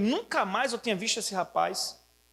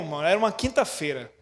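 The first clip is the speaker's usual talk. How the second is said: ordinary talk, nothing marked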